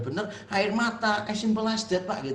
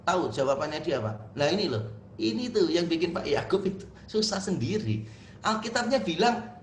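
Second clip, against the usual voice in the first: normal talking voice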